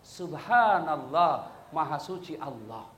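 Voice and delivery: low, drawn-out devotional phrase; reverent correction. Shown as a demonstration. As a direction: intone it slowly and low to prompt the imam. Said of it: "Tapi apa diucapkan?".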